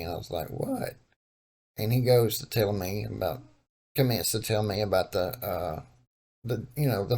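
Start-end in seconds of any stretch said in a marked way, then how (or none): 0.5-1.0 high, surprised "What?"; mock surprise to his cousin. Feigning surprise on the phone.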